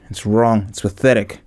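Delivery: ordinary talk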